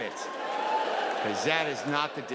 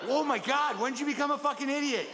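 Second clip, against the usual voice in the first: mock disbelief; 10 semitones higher than usual. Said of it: "I'm like".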